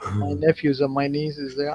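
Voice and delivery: ordinary delivery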